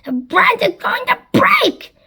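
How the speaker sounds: high, loud alarmed cry; shouted. A narrator's reading. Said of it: "as Sponge shouted".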